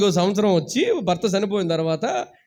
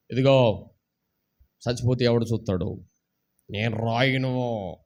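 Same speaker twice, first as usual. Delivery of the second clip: imitating a stubborn old man; 10 semitones lower, 3 dB quieter than usual. Dying old man quoted.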